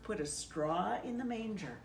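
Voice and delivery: ordinary delivery, nothing marked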